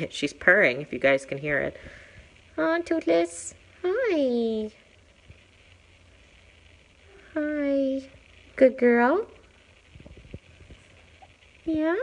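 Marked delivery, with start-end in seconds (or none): 2.6-12.0 much higher cooing at a cat; cooing pet talk. Real, not acted. Greeting shelter cat Toothless, in high cooing pet talk.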